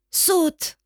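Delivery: brother's insistent call; shouted again. A narrator's reading. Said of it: "crie encore mon frère".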